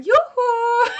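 very high, loud laughing yoo-hoo; sing-song yoo-hoo. Retold or imitated. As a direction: trill it out like a cozy Norwegian. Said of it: "and going".

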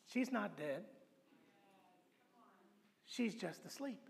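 soft, hushed reassurance; calm Jesus. Retold or imitated. Jesus quietly telling weeping mourners, soft and calm. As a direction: gently settle the mourners.

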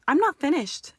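high, loud furious outburst; spat. Acted. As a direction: spit it at him, cutting in. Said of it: "she spat".

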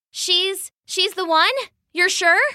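high, doubtful questioning; unsure surprise. Acted. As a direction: check with the bees in disbelief. Asking the bees.

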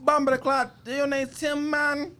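put-on slow, high Jamaican accent; playful Jamaican accent, drawn out. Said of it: "That's my Jamaican accent".

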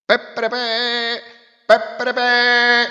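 trumpet fanfare. Acted. Trumpets announcing the parade, sung fanfare. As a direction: blare it like trumpets. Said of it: "Le trombe annunciarono".

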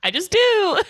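high, loud, comically exaggerated retort; mock insistent comeback. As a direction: brush off the question with silly insistence. Killer's comeback, mocked.

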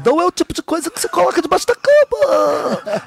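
high, cartoonish Bob Esponja-like host; Gugu impression. Comedian voices Gugu refusing to look under the bed, cartoon-high. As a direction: answer like a silly cartoon host.

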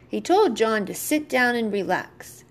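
ordinary delivery

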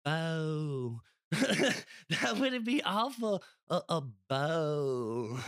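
high mock London accent, drawn-out ending. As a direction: imitate a Londoner, stretching the last word. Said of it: "I know from London".

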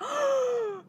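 high, sharp fake gasp; mock excited gasp. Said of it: "I'm not gonna be like".

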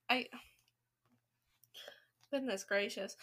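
ordinary delivery

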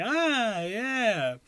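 high awkward forced yeah; awkward polite laugh. Retold or imitated. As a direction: fake a polite chuckle. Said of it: "I was like".

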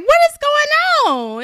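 high, loud mock disbelief; retold bewilderment. Co-host reacting to the rapper's accent, loudly baffled. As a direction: cry out in exaggerated confusion. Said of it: "I'm like".

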